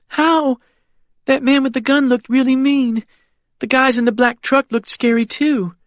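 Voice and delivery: put-on high, worried child voice; a small girl asking her father for reassurance; anxious little girl. Child character Annie.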